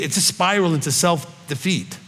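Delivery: ordinary delivery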